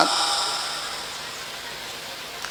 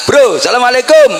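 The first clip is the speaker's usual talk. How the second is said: high, loud, breezy call across the street; casual greeting to a friend; 9 semitones higher, 5 dB louder than usual. Shown as a demonstration. Greeting a friend in the street, high and loud. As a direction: shout it out to a mate you spot on the road. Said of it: "kalau ketemu temen di tengah jalan".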